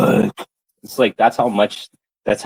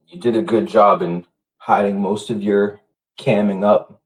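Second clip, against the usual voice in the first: ordinary delivery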